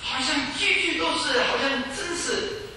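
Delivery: impassioned preaching. Acted. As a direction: drive the scripture home with fervour. Preaching at full force.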